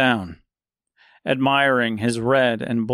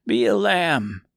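put-on slow, higher, kindly old woman; 6 semitones higher than usual. Seamstress kindly asking the scarecrow a favor. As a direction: coax him gently. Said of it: "said the seamstress kindly".

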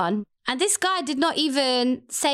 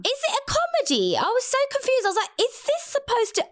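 much higher, bewildered; incredulous; 13 semitones higher than usual. Real, not acted. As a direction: squeal it in disbelief. Incredulous at movie being comedy.

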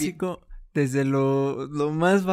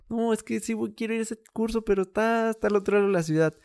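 whiny excuse; 7 semitones higher than usual. Retold or imitated. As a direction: imitate a lazy excuse-maker. Mocking an excuse-maker.

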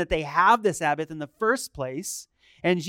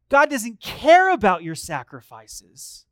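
ordinary delivery

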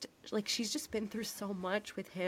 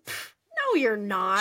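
mock dismissive; 3 semitones higher, 10 dB louder, slower than usual. Host acts out wife dismissing husband's heart attack, mock scornful. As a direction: scoff it off loudly.

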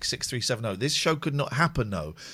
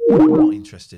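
mock dismissal; 13 semitones higher, 10 dB louder than usual. Rejecting BBC dramas, repeated.

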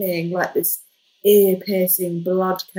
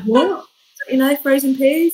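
higher, louder confused query; puzzled doctor voice; 8 semitones higher, 7 dB louder than usual. Retold or imitated. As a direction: ask like he missed the joke.

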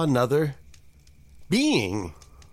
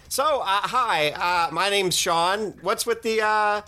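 higher, halting nervous voice; awkward Sean; 6 semitones higher than usual. Acted. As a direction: fumble the question shyly. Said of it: "was like".

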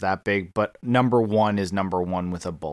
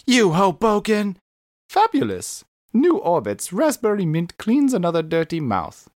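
higher, bright announcer-style ad voice; ad read; 9 semitones higher, 3 dB louder than usual. Retold or imitated. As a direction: sell the gum like a commercial. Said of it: "so it goes".

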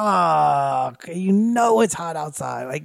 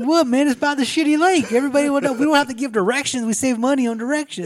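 much higher, louder smug owner voice; mock owner; 8 semitones higher, 4 dB louder, faster than usual. Friend voices a putt-putt owner defending the name, high and loud. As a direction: sell the bad name like a proud owner.

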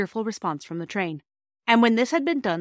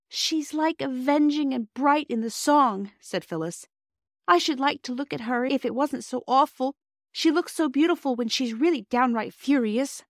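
put-on higher, a small wide-eyed girl; little girl voice; 6 semitones higher than usual. Phyllis to her siblings about angry Mother, higher girlish voice. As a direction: pitch it up, breathless with wonder.

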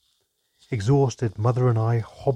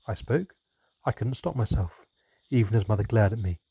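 ordinary delivery

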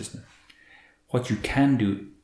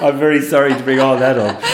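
louder, higher, through laughter; laughing apology; 4 semitones higher, 8 dB louder than usual. Real, not acted. Guest jokes with host about bringing up a celebrity split, laughing. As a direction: apologise while laughing along.

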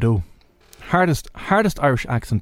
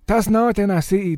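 north Dublin accent; 7 semitones higher than usual. Shown as a demonstration. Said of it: "north side of Dublin".

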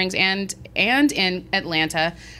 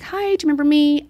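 higher-pitched retold hello; mock-friendly greeting; 6 semitones higher, 3 dB louder than usual. Guest greeting the same clerk next day, mock-friendly. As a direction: put on a high, friendly greeting.

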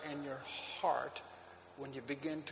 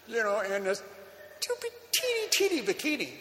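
excited; 8 semitones higher than usual. Retold or imitated. Excited retelling of surprise.

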